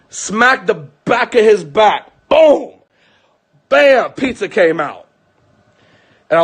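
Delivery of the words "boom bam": loud, high exclamations; punched impact words. Retold or imitated. Father re-enacting smacks on a choking child's back, punched exclamations. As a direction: fire each word out like a blow.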